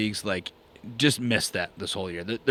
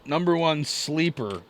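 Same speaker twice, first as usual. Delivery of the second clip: normal talking voice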